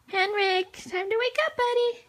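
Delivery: high sing-song wake-up. Real, not acted. Sing-song waking baby.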